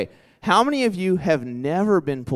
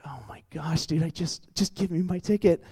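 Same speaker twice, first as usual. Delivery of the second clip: hushed, breathless plea under the breath; panicked whisper; 3 semitones higher, 5 dB quieter than usual. Retold or imitated. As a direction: whisper it like you're begging the cop. Caught by cop, retold panic.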